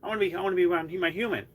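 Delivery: high, fast eager cat voice; lightly voiced clingy cat; voicing the cat. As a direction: gush like a needy pet. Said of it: "he's like".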